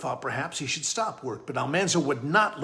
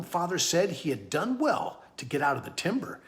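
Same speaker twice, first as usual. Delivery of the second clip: ordinary delivery, nothing marked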